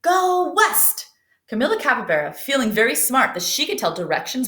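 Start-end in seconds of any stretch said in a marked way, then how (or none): 0.0-1.1 strong call. Acted. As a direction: call it out proudly. Wadney repeats strong command.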